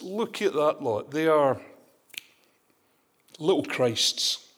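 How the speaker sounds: sneering at that lot, slowing on little Christs; mocking derision. Outsiders in Antioch jeer at the believers, mildly mocking. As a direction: scoff at them like outsiders pointing. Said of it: "it was a term of derision".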